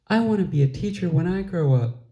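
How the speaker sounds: put-on a little girl bursting to tell her dream; eager little girl. A narrator's reading.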